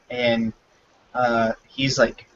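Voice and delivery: ordinary delivery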